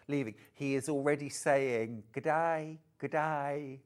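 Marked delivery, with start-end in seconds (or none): 2.1-3.8 put-on Australian accent. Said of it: "takes this accent on so quick".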